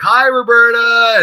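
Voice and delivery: high, slow, drawn-out group hello; chorus greeting. Retold or imitated. The whole team greeting Roberta, high chorus hello. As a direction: answer in unison, high and stretched. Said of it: "they're like".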